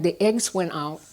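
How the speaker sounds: ordinary talk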